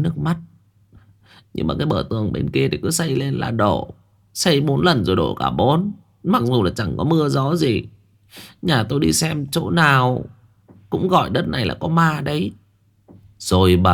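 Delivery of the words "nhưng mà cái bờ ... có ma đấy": put-on higher, begging voice of a frightened old woman; pleading old woman. Bà Đót kneeling, begging the shaman, higher pleading voice. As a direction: beg the master for help.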